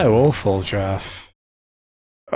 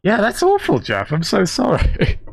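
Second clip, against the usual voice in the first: very high, through giggles; laughing sympathy; 10 semitones higher than usual. Real, not acted. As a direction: sympathise while cracking up. Laughing at friend's misfortune.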